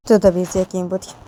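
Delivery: ordinary delivery, nothing marked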